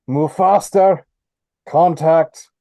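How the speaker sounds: loud, high, drawn-out yell; yelled warning. Acted. Soldier to figures in the woods, yelling out. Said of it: "he's gonna yell out".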